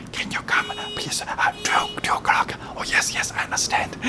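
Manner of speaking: imitated hushed conversation; soundless whisper. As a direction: whisper it all, no voice at all. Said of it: "There's no sound".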